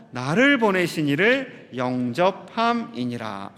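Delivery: slow, loud, measured recitation of the verse; deliberate, weighty reading of scripture; solemn scripture reading. Acted. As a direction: read the Bible verse aloud with the congregation, slowly. Congregation reads scripture aloud.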